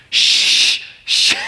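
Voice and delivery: long, forceful "shh"; loud parental shush; urgent shushing. Retold or imitated. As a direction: hush a screaming toddler hard. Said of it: "He was screaming, and I'm like, I ran in there".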